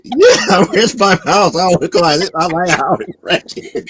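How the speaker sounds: high, giggling granny imitation; laughing mock old lady. Listener imitating the old lady while laughing. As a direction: mimic her through laughter. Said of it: "It's like".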